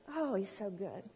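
soft, reverent aside; softly marvelling; hushed awe. Real, not acted. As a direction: drop down into quiet praise. Awed aside after the praise peak.

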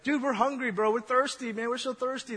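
lightly voiced whiny crowd begging; whiny Israelites. Preacher mimics the people complaining to Moses, mildly whiny. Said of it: "they're like".

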